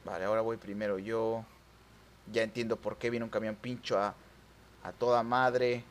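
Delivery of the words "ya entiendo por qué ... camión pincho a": ordinary talk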